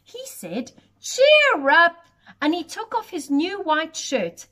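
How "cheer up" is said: loud bright encouragement; cheerful. Acted. Giant comforting the goat, loud cheerful call.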